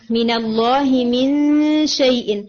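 مِن شَيْءٍ recited slowly, stopping on شَيْءٍ; recitation demo. Shown as a demonstration. Teacher showing the student where to stop, slow recitation. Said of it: "آپ نے یہاں وقف نہیں کیا".

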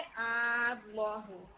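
अल्लाहु recited slowly with a drawn-out first vowel; elongated madd recitation. Shown as a demonstration. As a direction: hold the opening आ of अल्लाहु long before the doubled ल. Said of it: "यहाँ पर भी मद लाजिम कलमी".

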